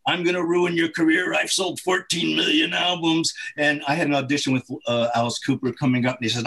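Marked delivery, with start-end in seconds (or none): 0.1-3.3 higher gravelly mafia guy. Retold or imitated. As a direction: growl the threat like a mafia heavy. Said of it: "he had this gravelly voice".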